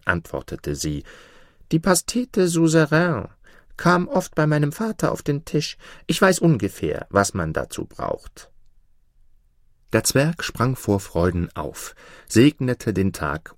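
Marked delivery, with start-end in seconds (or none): 1.7-8.5 high-pitched storybook goose; helpful goose. A narrator's reading. Goose tells the dwarf she knows the recipe, helpful.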